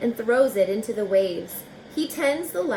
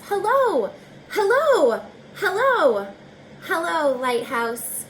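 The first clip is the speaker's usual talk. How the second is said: high ringing hello calls, loud; calling out; 7 semitones higher, 4 dB louder than usual. Acted. Reader calling hello to the lighthouse, loud and high. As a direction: call across the sea to the lighthouse.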